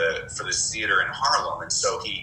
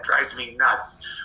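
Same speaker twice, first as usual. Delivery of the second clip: ordinary delivery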